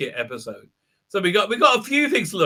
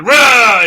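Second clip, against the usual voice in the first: loud, high mock roar; sudden hammed-up creature roar; imitated monster roar; 6 semitones higher, 11 dB louder than usual. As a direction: roar like a big beast.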